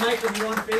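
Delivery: normal talking voice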